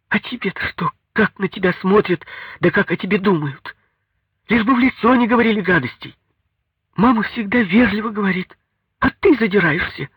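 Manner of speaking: very high, heated retort; indignant wife in a quarrel; reproachful wife. A narrator's reading. Wife scolds husband back in quarrel.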